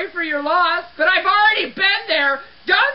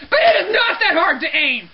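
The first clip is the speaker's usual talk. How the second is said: exasperated yell. Acted. Cleaner resuming the rant after an apology, exasperated yell. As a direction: blurt it out in comic outrage.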